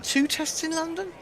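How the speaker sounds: high, disbelieving question; can't believe what he's hearing; incredulous echo. Real, not acted. Echoing a colleague in disbelief, pitched high and incredulous.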